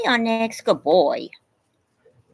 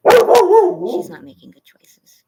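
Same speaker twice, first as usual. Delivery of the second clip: loud, high, slow scolding call; 14 semitones higher, 12 dB louder, much slower than usual. Real, not acted. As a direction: drag it out loud so the dog hears. Scolding the misbehaving dog aloud.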